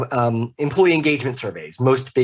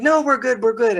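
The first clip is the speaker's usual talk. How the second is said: much higher, lightly voiced client waving off the offer; mock client brushing off help; 10 semitones higher than usual. Client turning down the consultant's help, mock breezy brush-off. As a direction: toss it off high, like a client who thinks they're fine. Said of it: "And like".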